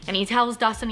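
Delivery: ordinary talk, nothing marked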